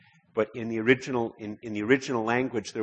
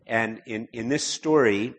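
usual speaking voice

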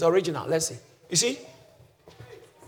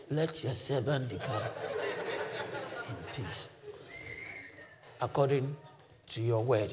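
put-on very soft, low, weak old man; fading, frail prayer with long pauses; Simeon; 5 semitones lower, 12 dB quieter than usual. Old man asking God to let him go, feebly. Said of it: "Weak, but just".

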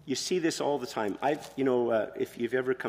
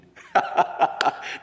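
amused laugh; 6 semitones higher, 5 dB louder than usual. Real, not acted. Laughing at movie's year.

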